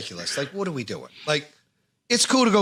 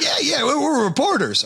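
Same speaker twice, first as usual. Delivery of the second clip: high-pitched, eager mock agreement; retold eager bluff; 10 semitones higher than usual. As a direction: jump in with a hasty, higher-pitched imitation. Retold friend eagerly bluffing to strangers.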